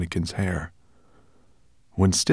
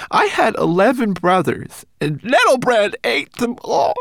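bitter little homunculus; 9 semitones higher, 4 dB louder than usual. Acted. Homunculus telling Ben of his brothers, high sorrowful voice. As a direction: mourn his lost brothers.

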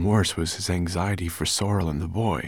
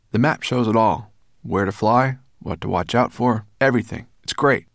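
higher, louder, eager listing; excited boy; 5 semitones higher, 6 dB louder than usual. A narrator's reading. The boy praising the map to the dragon, excited. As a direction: build enthusiasm through the list.

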